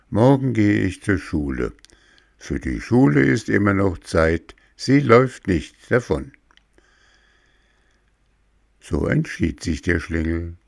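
plain reading voice

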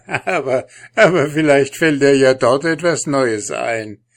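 loud, high sneering taunt; mocking. A narrator's reading. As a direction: jeer at him cruelly. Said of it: "fügte er mit höhnischem Lachen hinzu".

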